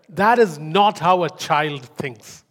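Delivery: normal talking voice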